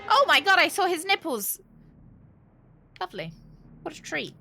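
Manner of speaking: normal talking voice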